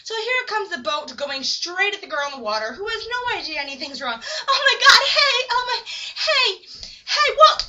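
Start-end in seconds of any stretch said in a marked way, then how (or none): 4.5-7.7 high, loud mock alarm; frantic hammed-up calls to stop; panicked shouting. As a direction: shout as if about to be hit. Panicked shouting at oncoming boat.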